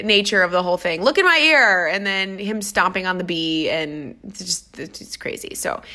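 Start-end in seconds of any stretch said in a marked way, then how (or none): 1.0-1.9 urgent plea. Retold or imitated. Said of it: "retelling pleading to client".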